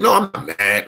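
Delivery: high, heated no I'm not mad; defensive denial. Real, not acted. Streamer answering a chat troll, high and defensive. Denies being mad, voice jumps up.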